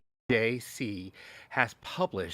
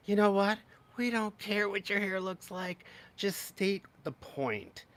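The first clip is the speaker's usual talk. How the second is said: exasperated; 9 semitones higher than usual. Real, not acted. Scolding the vice president.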